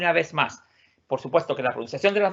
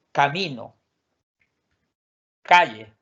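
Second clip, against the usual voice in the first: ordinary delivery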